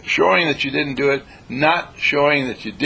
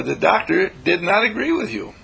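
normal talking voice

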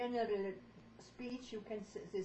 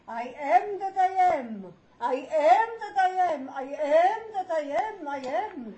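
much louder and higher; louder on the teacher's signal; more volume; 6 semitones higher, 11 dB louder than usual. Shown as a demonstration. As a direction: pour volume into it like a choir. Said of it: "A little more volume".

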